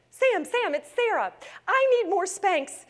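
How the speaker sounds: high, breathless excitement, Sam's name called out; can barely contain the news; excited call. Retold or imitated. Calling Sam from the hosiery mill, bursting with excitement.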